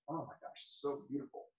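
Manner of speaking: whispered awe over the book; hushed admiration. Real, not acted. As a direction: breathe it out in awe. Admiring her book's quote section.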